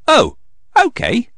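put-on high, light little-girl voice, agreeing; agreeing Peppa. Peppa agrees to give up her toy, high voice. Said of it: "agrees Peppa".

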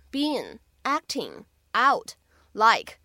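"been acting out like" with a pause after each word; "been acting out like" in isolated words. Shown as a demonstration. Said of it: "所以这样的四个单词".

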